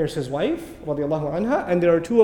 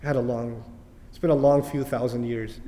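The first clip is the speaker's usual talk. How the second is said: softer, lightly voiced weary devil; a hint of a devil clocking off; mock-weary shaitan, understated; tired shaitan; 7 semitones lower, 7 dB quieter than usual. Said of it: "It's not like he sees an opening and says".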